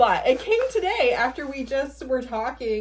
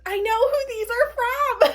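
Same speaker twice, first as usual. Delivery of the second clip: very high, emotional squeal; giddy recognition, pitched way up; so excited; 11 semitones higher than usual. Real, not acted. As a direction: squeak it out, overwhelmed with delight. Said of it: "I'm so excited".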